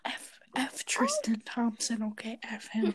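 hushed unvoiced whisper. Real, not acted. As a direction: whisper the insult. Whispered jab at player.

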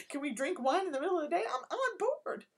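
high, loud, fast gleeful cheer; mock eager joiner. Joining the French setting things on fire, hammed-up excitement. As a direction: jump in with hammed-up enthusiasm. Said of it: "I'm like".

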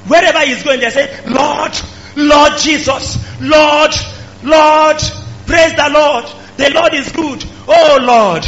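high, loud, shouted cries of Lord; hammed-up fervent worshippers calling out; loud mocking chant of Lord, Lord; mock crowd crying Lord. Preacher mimics crowd crying Lord.

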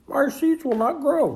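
high-pitched frustrated wail; upset complaint. A narrator's reading. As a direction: complain in high-voiced dismay. Toad complaining to Frog about seeds.

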